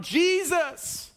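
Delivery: shouting out. A narrator's reading. Blind beggar crying out to passing Jesus, pleading shout. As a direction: call out desperately across a crowd. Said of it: "he began to shout".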